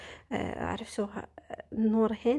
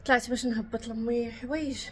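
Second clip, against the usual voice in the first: out of breath; 3 semitones higher than usual. Real, not acted. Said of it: "عييت نيت".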